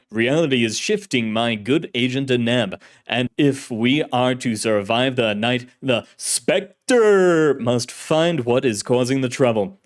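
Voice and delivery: loud, portentous serial hero, drawing out his name; old-time radio hero. Acted. Shadowy hero warns the agent before leaving, booming radio-serial voice. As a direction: announce danger with grand melodrama. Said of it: "voice was like an actor in an old-fashioned serial".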